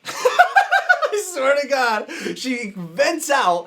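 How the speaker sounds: high, loud, real laughing speech. Real, not acted. Storyteller cracking up at her venting, laughing loudly. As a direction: talk through a big laugh.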